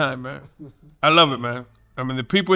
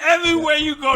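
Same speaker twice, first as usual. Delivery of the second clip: usual speaking voice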